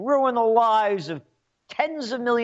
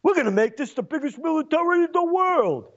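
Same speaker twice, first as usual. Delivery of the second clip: much higher, in a put-on voice, brash and boastful; mock Trump boast; 9 semitones higher than usual. Ranter parodies Trump promising the biggest military, pompous and higher. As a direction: puff it up like a bragging president. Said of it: "Trump says".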